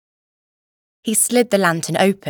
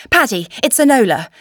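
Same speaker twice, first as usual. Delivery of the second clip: urgent call; 5 semitones higher, 6 dB louder than usual. Acted. Calling to the boy in rescue.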